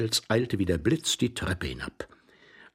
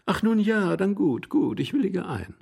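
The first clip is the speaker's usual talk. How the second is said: lady, giving in; 10 semitones higher than usual. Acted. Lady agrees to the hoarse man's offer, high voice. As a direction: give in with a high, lilting voice.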